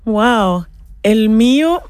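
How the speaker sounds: ordinary talk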